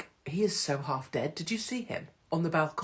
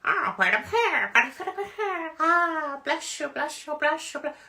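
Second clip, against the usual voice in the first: much higher, slower, hammed-up priestly voice; mock cardinal blessing; 11 semitones higher, 3 dB louder than usual. Cardinal working the Pope's arms, a much higher hammed blessing. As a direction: ham up a cardinal blessing the crowd. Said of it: "a cardinal, going".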